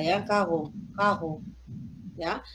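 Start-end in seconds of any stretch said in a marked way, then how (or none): 0.2-1.4 slow demo, "carro" as "kaɣo"; weakened G. Shown as a demonstration. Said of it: "esta es la G debilitada".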